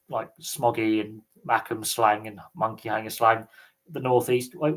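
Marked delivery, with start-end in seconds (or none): none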